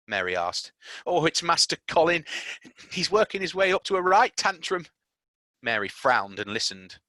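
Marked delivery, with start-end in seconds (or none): 1.1-4.9 higher maid's voice, worried; lightly voiced anxious maid; Martha's voice. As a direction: maid warning Mary about Colin.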